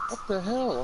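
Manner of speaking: high, incredulous "What the hell?"; shocked exclaim. Retold or imitated. Retelling his shock at the kids sharing food, high and disbelieving. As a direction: gasp it out in disbelief. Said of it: "And I'm like".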